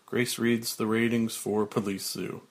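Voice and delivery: ordinary delivery, nothing marked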